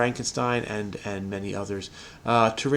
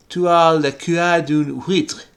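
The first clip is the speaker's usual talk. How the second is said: slow, exaggerated fake French; 4 semitones higher, 4 dB louder, slower than usual. Shown as a demonstration. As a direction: ham up a French accent. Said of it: "how you pronounce that in fake French".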